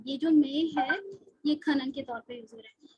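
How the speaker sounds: ordinary delivery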